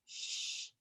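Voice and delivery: gentle unvoiced shh; a quiet shushing breath; soft shush. A narrator's reading. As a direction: hush a sleepy child. Hushing the truck to sleep.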